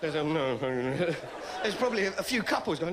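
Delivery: normal talking voice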